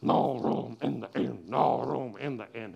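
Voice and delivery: deep, put-on innkeeper voice. Innkeeper in a nativity play turns guests away, deep-voiced. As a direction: drop low and play the innkeeper turning people away. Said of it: "this innkeeper who comes and says".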